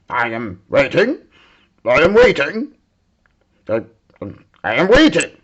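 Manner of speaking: loud, insistent demand for an answer; a hint of a stern father waiting on his daughter; impatient king. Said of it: "said the king".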